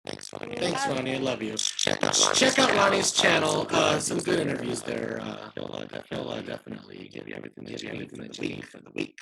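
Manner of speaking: very low, doubled-sounding voice; Luann voice. Real, not acted. Host thanking Ronnie at sign-off, very low Luann-like voice. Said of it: "[name]'s got the Luann voice".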